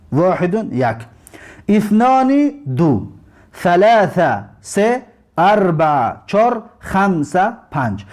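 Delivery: slow, deliberate counting for learners; slow teacher's count; counting slowly. Shown as a demonstration. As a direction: give each Arabic number and its meaning slowly. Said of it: "این رو نوشتنش هم یاد بگیرید".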